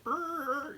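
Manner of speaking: high-pitched imitated tire squeal; long high vocal screech; Batmobile screech. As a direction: mimic a car screeching away.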